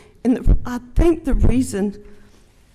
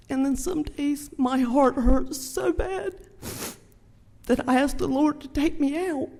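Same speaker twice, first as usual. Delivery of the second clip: trying to get through it without crying; high, breaking tearful voice fading into a whisper; real tearful; 7 semitones higher than usual. Real, not acted. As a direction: voice rising high and choked with tears. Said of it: "I need a tissue".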